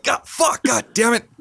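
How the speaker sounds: high exasperated mock curse; mock dismay. Retelling friend being peed on by the baby, mock cursing. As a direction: curse like you just got sprayed. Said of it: "he's like".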